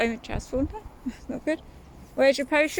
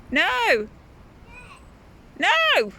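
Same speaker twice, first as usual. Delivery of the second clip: higher, louder calls across the garden; calling out; 9 semitones higher, 4 dB louder, slower than usual. Real, not acted. Mum calls across to her child about bare feet, raised voice.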